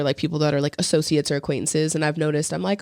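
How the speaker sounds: ordinary delivery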